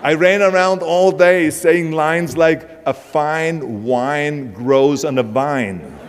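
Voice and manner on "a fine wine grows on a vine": slow, drawn-out "fine wine ... vine"; accent removal drill. Acted. Actor recalling practice lines from accent removal classes, slow careful diction drill. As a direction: stretch "fine", "wine" and "vine", keeping each sound apart. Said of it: "even accent removal classes".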